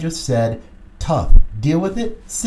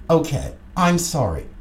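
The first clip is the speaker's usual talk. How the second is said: usual speaking voice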